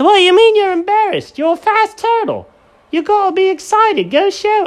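very high, loud cartoon voice; squeaky Jenkins. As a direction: crank the pitch way up as Jenkins.

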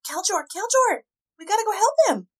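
high, loud urgent plea; urgent call. Acted. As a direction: call out to him in alarm.

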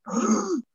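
high, sharp gasp for air; mock gasp. Waking with the cat's weight on the chest, mock gasp. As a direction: suck in air as if smothered.

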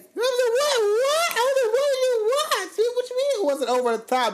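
very high, loud screech of disbelief; mock outrage. Co-host challenging the Beyonce remark, high mock-outraged screech. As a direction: screech it out, far above your normal pitch.

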